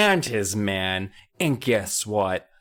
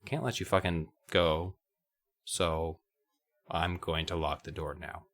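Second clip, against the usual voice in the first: quiet mock wizard; 7 semitones lower, 10 dB quieter than usual. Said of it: "He's like".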